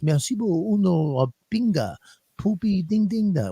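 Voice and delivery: mock French gibberish. Contrasting gentle French with angry languages, mock French gibberish. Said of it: "it's not like French".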